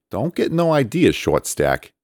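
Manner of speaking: high put-on character voice, a light warning; playful threat from a big guy; teasing warning. A narrator's reading. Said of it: "he warned".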